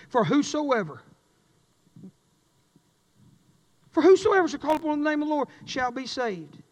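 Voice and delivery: fervent preacher reading Romans aloud; scripture preaching. Acted. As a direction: proclaim the verse from the pulpit. Preacher reciting Romans at full force.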